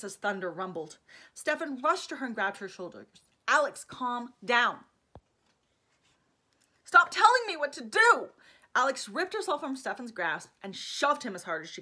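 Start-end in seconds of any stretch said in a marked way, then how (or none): 3.5-4.8 firm calm-down. Acted. Stefan grabbing her shoulders. 6.8-8.3 high, loud furious yell; furious shout. Acted. Alex screaming furiously at Stefan to stop ordering her.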